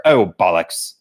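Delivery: slow mock English accent. Mocking Americans back from London, affected English accent. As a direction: drawl it in a put-on English accent. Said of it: "suddenly they're speaking in the English accent".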